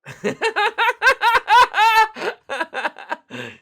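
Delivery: very high, loud burst of laughter; helpless high cackling; loud cackle. Real, not acted. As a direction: explode into long shrieking laughter. Bursts out at rude question.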